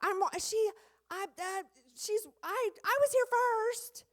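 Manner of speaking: mock kid complaint; squabbling child. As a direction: kids fighting at the sink. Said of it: "Five kids ... splashing water".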